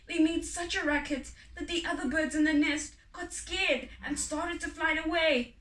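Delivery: ordinary delivery, nothing marked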